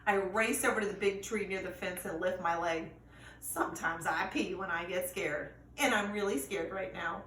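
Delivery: ordinary delivery, nothing marked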